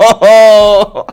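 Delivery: a high, loud "Oh!" of amused surprise; delighted exclamation. Real, not acted. Host reacts to the boy's camera look.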